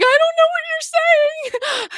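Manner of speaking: high, drawn-out mock confusion; baffled interviewer. Acts out baffled interviewer, much higher.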